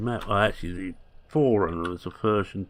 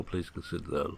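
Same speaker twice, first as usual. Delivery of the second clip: ordinary talk, nothing marked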